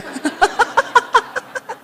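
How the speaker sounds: high rippling laugh. Real, not acted. Speaker amused by the Solo impression, high laughter.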